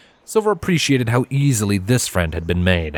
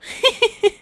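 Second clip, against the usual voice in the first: giggle. Acted. Twilight giggling at the flirt.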